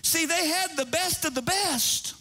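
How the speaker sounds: full-force Sunday preaching. Acted.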